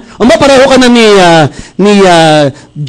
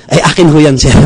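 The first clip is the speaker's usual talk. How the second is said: humble barber; 8 semitones lower than usual. Retold or imitated. Barber humbly answers customer.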